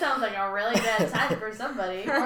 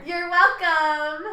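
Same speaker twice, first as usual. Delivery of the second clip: put-on slow, drawn-out Valley Girl; a really bad Valley Girl; 4 semitones higher, 6 dB louder, much slower than usual. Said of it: "That was a really bad, like, Valley Girl voice".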